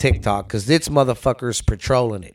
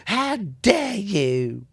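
softer, slow mock scolding, higher; drawn-out mock reproach, pitched up; mocking Greta; 7 semitones higher, 4 dB quieter, much slower than usual. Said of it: "I'm gonna look at her face and go".

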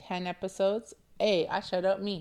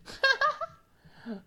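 laughing; 5 dB louder than usual. Real, not acted. Bragging about poetic affirmation.

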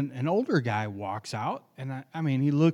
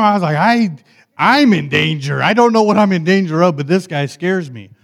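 fiery testimony; 4 semitones higher, 11 dB louder than usual. Acted. Preaching testimony at full force.

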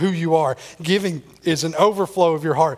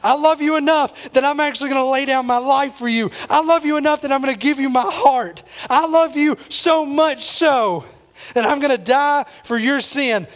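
impassioned preaching; 7 semitones higher than usual. Acted. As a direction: pour out the love at full force. Preacher voices God's love at full force.

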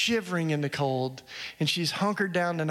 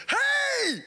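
high excited shout of hey; woman jumping in the door, calling out excitedly; excited greeting. Retold or imitated. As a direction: burst in with a bright high hey.

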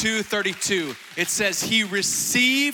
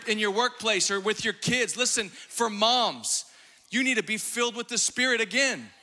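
ordinary delivery

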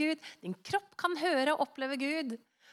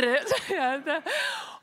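laughing; 4 semitones higher than usual. Real, not acted. Laughing at own story.

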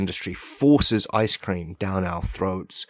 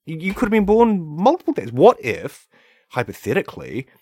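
worked-up rant; 5 semitones higher, 5 dB louder than usual. Real, not acted. Heated rant.

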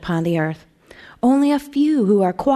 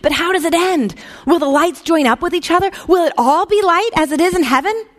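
higher, eager, impatient questions; 8 semitones higher than usual. A narrator's reading. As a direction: press for the ending impatiently. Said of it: "said the little angel impatiently".

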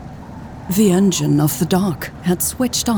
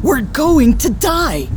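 raised-pitch panicked cry, not full volume; screamed; 6 semitones higher than usual. A narrator's reading. Louise warns Hobbs they will die, screamed. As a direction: cry it out high, a contained scream. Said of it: "she screamed at Hobbs".

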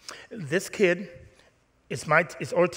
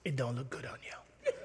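mock hushed aside; 5 semitones lower, 14 dB quieter than usual. Quiet aside to congregant.

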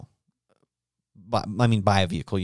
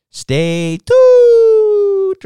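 slow, very high, loud falsetto squeal; falsetto sign-off; 11 dB louder than usual. Pretend. Host tries out a sign-off catchphrase in a silly falsetto.

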